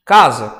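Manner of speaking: Casa with a strong CA, weaker za; stress on first syllable. Shown as a demonstration. Teacher showing students the tonic syllable, Casa stressed on CA. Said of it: "ele é pronunciado mais forte do que o za".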